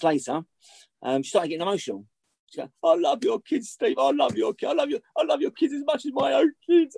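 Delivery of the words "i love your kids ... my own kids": high, tearful mock plea; imitating her crying. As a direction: raise the voice high and wobble it like she's in tears. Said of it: "she started crying".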